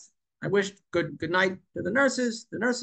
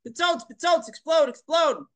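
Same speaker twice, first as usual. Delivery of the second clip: high mock yell at the sky, pitched way up; imitating a scream; 9 semitones higher, 4 dB louder than usual. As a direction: shout up at the rocket like the man on the corner. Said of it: "he started screaming at it".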